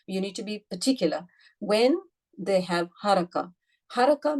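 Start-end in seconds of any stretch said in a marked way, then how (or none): none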